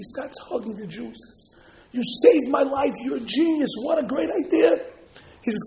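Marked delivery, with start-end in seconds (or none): 1.9-4.7 higher-pitched, gushing praise; elated gratitude. Acted. Rescued general hugging his saviour, elated. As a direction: gush with relief and thanks.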